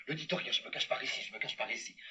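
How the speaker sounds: soft, low scheming aside; hushed. Acted. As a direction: hide without a sound. Villain hiding quietly.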